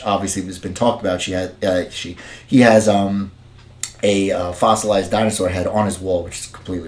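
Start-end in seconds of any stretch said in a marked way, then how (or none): none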